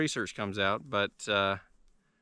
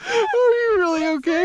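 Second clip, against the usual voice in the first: laughing concern; 10 dB louder than usual. Real, not acted. Checking on fallen friend, laughing.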